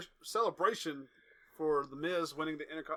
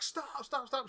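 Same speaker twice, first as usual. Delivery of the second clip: retold scream; 9 semitones higher, slower than usual.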